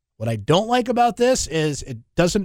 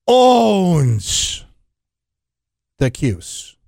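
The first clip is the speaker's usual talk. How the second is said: movie quote; 4 dB louder, much slower than usual. Retold or imitated. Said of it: "in the words of the owner ... in Slapshot".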